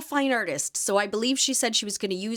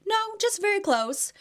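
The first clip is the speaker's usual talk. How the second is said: higher, airy brush-off in a put-on lady voice; mock dismissive lady; 12 semitones higher than usual. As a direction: play the dog owner waving it away, pitched up. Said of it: "she was like".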